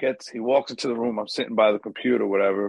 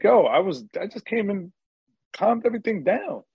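indignant protest; 9 semitones higher than usual. Retold or imitated. Bouncer tries to mush his face.

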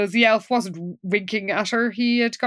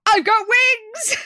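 high, stretched-out mock announcement; triumphant declaration; 11 semitones higher, slower than usual. Winston arrives at the party with chicken, mock-triumphant declaration. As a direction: proclaim it slowly, imitating a proud arrival. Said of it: "Winston arrives and declares".

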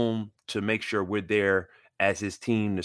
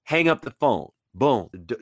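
normal talking voice

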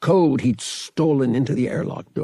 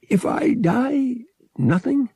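slurred; 13 semitones higher, 3 dB louder, slower than usual. A narrator's reading. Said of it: "her slurred speech".

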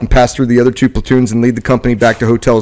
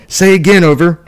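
urgent radio call, pitched up; crackly military radio; 7 semitones higher, 3 dB louder than usual. Acted. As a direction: shout it into a field radio. Radio call under grenade fire.

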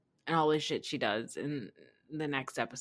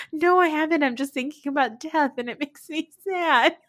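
much higher, odd sing-song mock of a sad woman; mock Vicky voice; 9 semitones higher, 6 dB louder than usual. Vicky in the woods telling the girls about death, high mock-sad voice. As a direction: ham up a tearful woman brooding on death, pitched way up. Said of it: "And Vicky's like".

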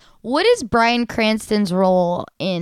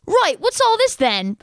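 put-on British accent; 10 semitones higher than usual. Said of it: "I love when British people say that".

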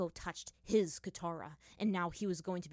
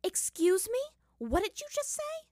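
much higher, louder outraged question; indignant anger; 6 dB louder than usual. Acted. Katara rounds on Aang, outraged and shrill. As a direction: fire the question back, outraged. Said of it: "equal amount of anger".

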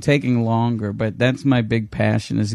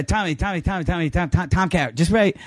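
higher, rapid repeated calls of the name; pestering; 6 semitones higher than usual. Retold or imitated. Sparky behind Tom at the signing, higher-pitched pestering. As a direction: nag for attention in a raised pitch.

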